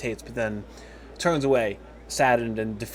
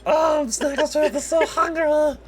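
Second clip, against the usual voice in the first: very high, slow squeaky whine; drawn-out high-pitched creature voice; hungry kobold; 4 dB louder than usual. Acted. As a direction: whine it like a starving little kobold. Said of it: "and he's like".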